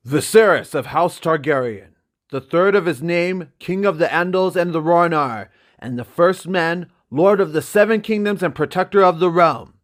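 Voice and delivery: loud, high formal proclamation; herald's announcement. Acted. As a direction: announce the titles to the whole hall. Herald announcing royal titles.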